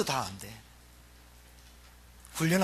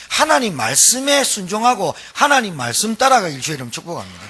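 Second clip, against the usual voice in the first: louder, driving sermon voice; preaching at full force; 5 semitones higher, 7 dB louder than usual. Acted. Preacher exhorting the congregation to obey, forceful preaching. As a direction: press the point home to the congregation. Preacher urges obedience to the Word.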